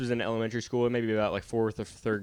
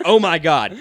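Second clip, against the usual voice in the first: retold exclamation; 6 semitones higher, 12 dB louder than usual. Kid reacting to getting kicked.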